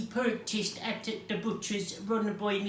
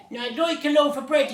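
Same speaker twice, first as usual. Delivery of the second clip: loud, raised storybook boom; 5 semitones higher, 7 dB louder, faster than usual. A narrator's reading. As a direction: boom out the request, higher. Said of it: "he boomed".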